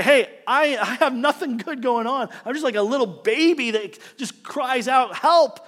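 mock infant wail on Help; helpless child cry. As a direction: cry out like a little baby. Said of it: "who say".

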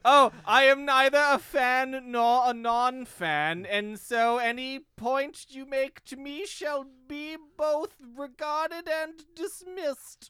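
high, slow, hammed-up pomposity; pretentious. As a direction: draw it out like a self-important snob. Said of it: "that's pretentious".

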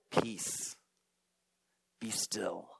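low soft whispered command; calm hushed command. Retold or imitated. Jesus speaking to the wind and waves, hushed and calm. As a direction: still the storm with a hush.

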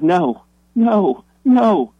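the lunch lady can't believe she won, rising to a loud no; stunned denial. Retold or imitated. Lady refusing to believe her win, loud stunned no.

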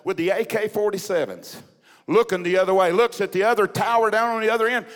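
loud, emphatic revival storytelling; impassioned preaching. Acted. Preacher telling church of a pastor's prison escape, impassioned. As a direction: preach the suspense with punched key words.

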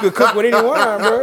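laughing while arguing. Real, not acted. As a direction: push the words out through laughter.

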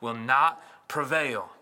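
slow, weighty, drawn-out promise; slow declaration. Shown as a demonstration. Preacher declaring Jesus' promise to the church, slow and weighty. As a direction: lean on not prevail.

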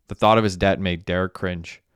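ordinary delivery, nothing marked

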